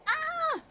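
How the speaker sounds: high yelp of alarm; startled yelp. Real, not acted. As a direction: yelp at the spill. Water spilling.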